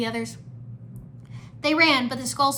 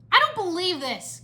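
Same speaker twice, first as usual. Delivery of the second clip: high, loud disbelief; exasperated exclamation; 8 semitones higher, 5 dB louder than usual. A narrator's reading. Lloyd watches captives bicker, exasperated exclamation. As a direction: exclaim it in frustration. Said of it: "Lloyd exclaimed".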